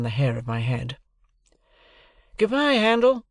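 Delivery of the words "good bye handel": loud, high farewell call; high, raised-voice send-off. A narrator's reading. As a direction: call goodbye after a departing coach. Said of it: "Herbert called out as we started".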